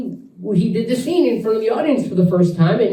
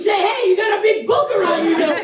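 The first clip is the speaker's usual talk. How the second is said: mocking Tony; 12 semitones higher than usual. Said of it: "He goes".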